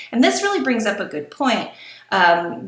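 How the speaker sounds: ordinary talk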